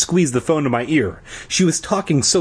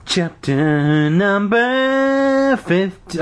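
slow, drawn-out chant climbing high; mock-grand high heading; hammed-up chapter announcement; 8 semitones higher, much slower than usual. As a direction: stretch every word out, sliding upward.

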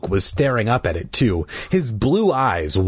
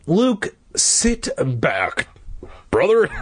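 slow, weary higher-pitched plea; pleading; 8 semitones higher, slower than usual. A narrator's reading. Dad to restless son, pleading. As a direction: draw it out, pleading with the boy. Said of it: "Dad pleaded".

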